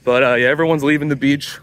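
usual speaking voice

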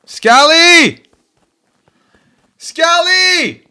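high, drawn-out shout of a name; real yelling. Real, not acted. Player calling out to another player, yelling. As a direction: yell out for a teammate at full voice. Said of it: "Why are you yelling?".

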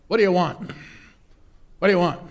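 gruff, loud innkeeper barking down; grumpy voice. Acted. Innkeeper yelling down at a knocking traveller, grumpy and loud. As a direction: snap at the stranger from the window. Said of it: "this grumpy voice came out and said".

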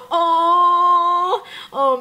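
a high, loud oh that catches with emotion; overwhelmed, nearly crying; choked-up gasp of feeling; teary oh. Real, not acted. Touched by elderly helper photo.

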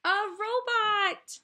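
high, loud, slowed cry; wide-eyed wonder, stretched out; excited cry. Acted. Gerald amazed at the robot, high loud slow cry.

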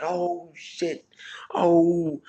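high-pitched mock crowd howl; imitated crowd reaction. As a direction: mimic onlookers crying out in shock.

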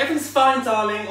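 high, loud, over-bright mock reassurance; mother insisting all's fine. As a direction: imitate a stressed mum covering up. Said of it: "you walk in, she goes".

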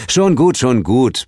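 high first SCHON GUT, then easing down; appeasing, slightly exasperated; placating. A narrator's reading. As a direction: soothe an offended partner hastily. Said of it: "beschwichtigte ich".

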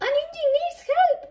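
very high, loud alarm; loud, high-pitched dispatcher's shout; booming call. A narrator's reading. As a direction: call out the emergency loudly. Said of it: "boomed Rocky".

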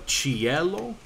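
"cielo" stressed on the E, chee-EH-lo; second-to-last syllable stress. Shown as a demonstration. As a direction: lean on the middle of "cielo". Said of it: "keeping the stress in the proper place".

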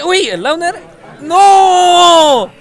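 screaming hype call, high and loud; wrestling commentator. Real, not acted. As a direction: lose it over the knockout. Final kill on stream.

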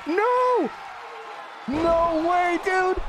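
very high, wailing protest; upset disbelief. Real, not acted. Player watching his finisher get stolen, high upset cry of disbelief. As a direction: cry out in high-pitched dismay. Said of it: "I'm so upset right now".

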